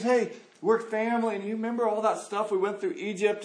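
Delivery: acted-out chummy appeal; friendly appeal. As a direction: coax a relative for a favor. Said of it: "appeals for a pa-, for passage".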